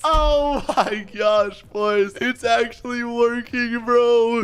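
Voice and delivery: drawn-out, laughing "oh my gosh"; laughing excited outburst. Real, not acted. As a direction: laugh through it, thrilled the prank works. Trolling friends, prank working.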